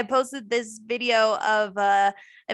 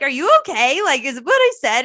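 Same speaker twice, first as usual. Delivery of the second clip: louder, higher worried question; anxious check-in; 3 semitones higher, 7 dB louder than usual. Retold or imitated. Worried woman asking her silent friend, anxious. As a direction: ask nervously if you upset him. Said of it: "And I was like".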